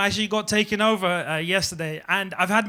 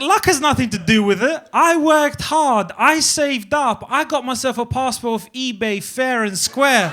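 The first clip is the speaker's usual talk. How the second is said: indignant self-defence; 3 semitones higher, 4 dB louder, slower than usual. Retold or imitated. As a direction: stretch each claim out with righteous indignation. Mock-proud defence against the lady.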